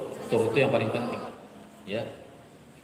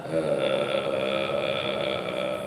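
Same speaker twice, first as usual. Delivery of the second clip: a deep low growl of a tone; lowest voice; 13 semitones lower than usual. Shown as a demonstration. Teacher demonstrates her lowest voice, deep and low. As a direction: drop to the very bottom of the voice. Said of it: "suara terendah saya adalah".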